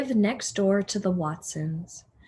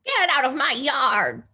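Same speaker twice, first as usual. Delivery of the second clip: louder, higher scolding shout; angry shout; 5 semitones higher, 5 dB louder than usual. Acted. Eugenia shouting at the pig through the window, angry. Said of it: "she shouted at Mercy".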